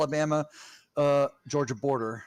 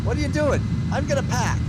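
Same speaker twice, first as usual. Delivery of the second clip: high, hammed-up Boston voice, "pack" without the R; Boston accent; 12 semitones higher than usual. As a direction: play a Boston local in a car ad. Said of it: "native Bostonians".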